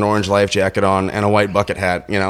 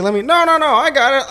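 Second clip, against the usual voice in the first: high, insistent imitation of a deckhand; hammed-up refusal, pitched way up; mock stubborn crewman. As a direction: brush off help in a squeaky put-on voice. Crewman refusing help, acted out.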